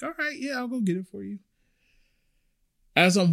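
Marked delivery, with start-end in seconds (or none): 0.0-1.4 high, soft friendly server voice; cheerful and helpful, higher. Retold or imitated. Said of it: "I'm like".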